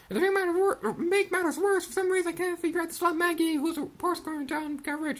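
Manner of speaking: put-on high girlish griping; high-voiced complaint ending in a mock growl; aggrieved poor girl. Voices girl's angry complaint.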